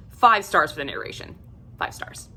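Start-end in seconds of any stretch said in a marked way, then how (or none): 1.8-2.3 whispered afterthought; whispered echo. Real, not acted.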